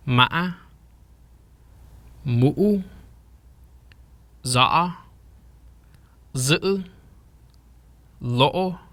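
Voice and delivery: "Ma'a, Mu'u, Za'a, Z'u, Lo'o" each broken by a throat catch, then rising; "Ma'a, Mu'u, Za'a, Z'u, Lo'o" as separate two-part rising syllables; wave tone demonstration. Shown as a demonstration. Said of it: "Here's some example sounds".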